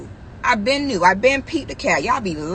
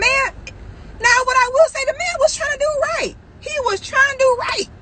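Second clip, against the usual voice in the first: squeaky, far higher voice, held up throughout; high-pitched insistence; 14 semitones higher than usual. Acted. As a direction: plead his case in a squeal. Mock plea defending the man.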